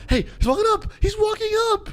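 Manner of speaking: very high-pitched excited cry; excited alert. Real, not acted. Streamer spots the guy walking up, shrilly excited. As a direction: squeal it out urgently. Bystander finally approaches him.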